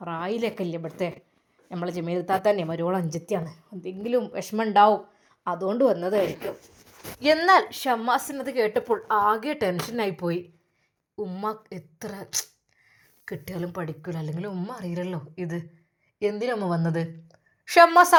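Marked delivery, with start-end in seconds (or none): 0.1-6.5 voiced lower for Shammas; a man calmly explaining from the next room; low man's voice. A narrator's reading. 11.2-17.0 low and hushed, tense; low tense muttering. A narrator's reading. Shammas muttering worried.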